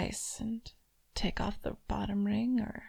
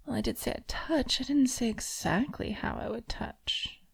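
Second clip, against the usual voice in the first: whisper. Acted. Mistress whispering to her toy, teasing. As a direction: breathe it close to the ear.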